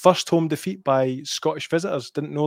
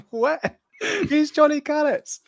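high-pitched, giggly disbelief; laughing bafflement; 13 semitones higher, slower than usual. Real, not acted. Host cracks up at his favourite comment, laughing bafflement. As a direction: squeak it out through real laughter.

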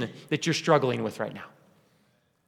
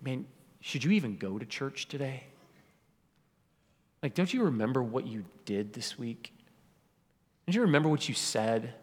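low, hushed inner accuser; insinuating whisper; 5 semitones lower, 9 dB quieter than usual. Acted. The enemy whispering doubts in a churchgoer's head, low and hushed. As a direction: lean in softly, planting doubt under your breath. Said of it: "It's that whisper in your head".

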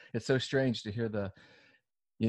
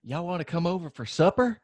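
a hint of a Southern host asking friends to supper; Southern accent; 6 semitones higher than usual. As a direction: toss off a folksy Southern invite. Mock Southern guest invite.

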